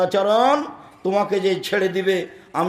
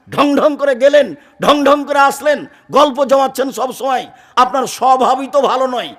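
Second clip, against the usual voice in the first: loud, high nagging rebuke; scolding wife; 7 semitones higher, 6 dB louder than usual. Acted. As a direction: tear into him like an annoyed wife. (স্ত্রীর উঁচু গলায় ঝাঁঝালো ধমক)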